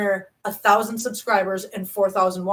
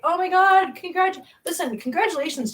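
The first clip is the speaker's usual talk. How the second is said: high-pitched gushing fan; mock excited congratulations; 9 semitones higher than usual. Creator imitating people congratulating her, excited and high. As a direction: imitate a thrilled well-wisher, voice pitched way up.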